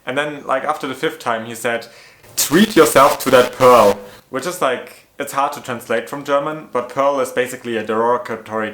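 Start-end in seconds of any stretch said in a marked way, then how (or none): 2.4-4.0 louder, slow, sleazy coworker; hammed-up loud slow leering quote; mock loud leering. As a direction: drawl it out like a crude creep. Coworker's sexist remark retold.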